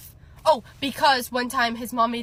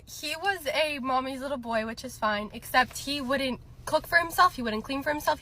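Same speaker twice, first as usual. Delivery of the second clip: ordinary talk, nothing marked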